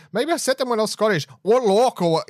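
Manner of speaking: high, loud mock Northern accent; a hammed-up take on the Doctor; imitating the Doctor's accent. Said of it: "the Doctor trying to unlock the handcuffs and be like".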